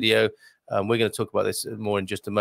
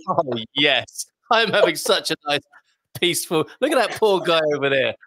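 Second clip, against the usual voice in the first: smug solo, laughing; 5 semitones higher, 3 dB louder than usual. Pretend. Imagined smug solos.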